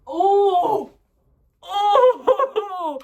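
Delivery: high, loud startled cry breaking into a laugh; surprised oh. Real, not acted. Vlogger reading her last topic, loud surprised laughing cry. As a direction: caught off guard, then amused.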